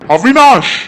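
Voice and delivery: a sudden shouted name, high and loud; furious shout. Acted. Uncle yells at Avinash for his proposal, furious shout. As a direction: roar his name in outrage. Uncle explodes at nephew's words.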